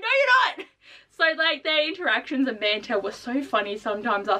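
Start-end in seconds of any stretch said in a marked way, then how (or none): 0.0-0.7 mock retort. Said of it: "she's like".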